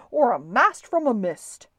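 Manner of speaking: loud yell in a shouting match. A narrator's reading. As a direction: yell it back to top the other. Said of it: "yelled the man".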